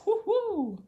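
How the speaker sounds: a high, delighted little woo-hoo; excited cheer. Real, not acted. Celebrating the point in an accent game, excited woo-hoo. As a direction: cheer yourself on for getting a point.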